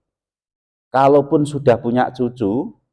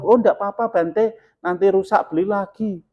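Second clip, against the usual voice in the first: higher, breezy dismissive reply; carefree brush-off; 9 semitones higher than usual. Retold or imitated. Follower telling the monk a broken phone doesn't matter, higher breezy brush-off. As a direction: shrug it off lightly.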